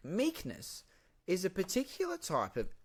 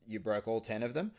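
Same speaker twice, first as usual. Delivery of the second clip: normal talking voice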